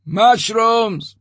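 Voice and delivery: a protester shouting at the major. A narrator's reading. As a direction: hurl the word from the crowd. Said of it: "someone shouted".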